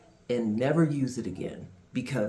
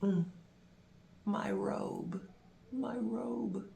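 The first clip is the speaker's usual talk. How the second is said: soft, heartbroken mumble of loss; mumbled under breath; 5 semitones higher, 9 dB quieter, slower than usual. Retold or imitated. At the cleaners' counter, mourning the lost robe, mumbled softly. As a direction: murmur it to yourself, barely aloud. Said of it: "mumbled under my breath".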